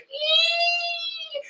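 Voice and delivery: high, thin mock squeal of fright; frightened squeal. Perospero squeaking in fright before Big Mom, a high mock squeal. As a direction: squeak like a cornered coward.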